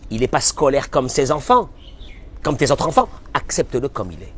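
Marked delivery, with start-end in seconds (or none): 3.3-4.3 whispered advice. Acted. Rabbi's advice quoted softly.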